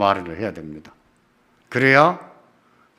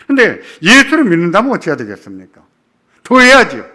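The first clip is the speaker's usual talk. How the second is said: much higher and louder, fast and driving; emphatic preaching; 9 semitones higher, 6 dB louder, faster than usual. Real, not acted. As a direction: build to a ringing pulpit climax. Preacher climaxes the point.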